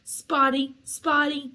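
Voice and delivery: high, loud name-calling; taunting yell. A narrator's reading. Poltergeist pelting fleeing ghost girl, taunting yell. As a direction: yell the nickname as a jeer.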